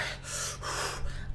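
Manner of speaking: heavy exhale while jogging; worn-out jogging breath; panting breath. Real, not acted. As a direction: breathe out hard, winded. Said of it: "I'm out of breath".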